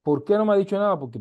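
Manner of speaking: normal talking voice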